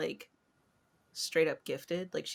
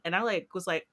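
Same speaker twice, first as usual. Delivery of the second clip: usual speaking voice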